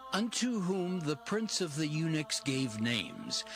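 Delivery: ordinary talk, nothing marked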